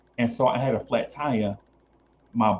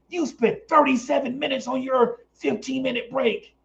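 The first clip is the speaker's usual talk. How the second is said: nagging boss; 11 semitones higher than usual. Retold or imitated. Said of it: "Oh, Easy".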